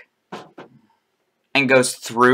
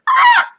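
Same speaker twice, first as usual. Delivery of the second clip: imitated high-pitched squawk; very high, short animal cry; creature squawk; 7 dB louder than usual. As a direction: squawk like a startled creature as it gets hit. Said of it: "You hear".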